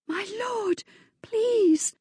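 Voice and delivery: high, frightened begging; a girl begging a furious lord to stop; frightened plea. Acted. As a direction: plead with him, scared. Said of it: "The girl's voice held a thread of fear".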